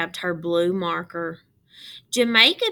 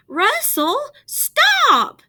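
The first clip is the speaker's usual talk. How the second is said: high, loud storybook shout; shouting stop; 9 dB louder, much slower than usual. A narrator's reading. Jamaica shouting at Russell to stop, high and loud. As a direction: cry out to stop him. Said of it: "Jamaica shouted".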